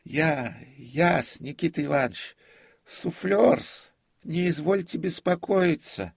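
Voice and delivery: put-on high, loud, obsequious old servant; prompter's voice. A narrator's reading. Prompter calms the terrified comic, high servile voice. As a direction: reassure deferentially in a high reedy pitch.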